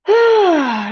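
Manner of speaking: long-suffering stage sigh; exaggerated sigh. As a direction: heave a sigh like it's already been a long night. Dramatic sigh before a long night.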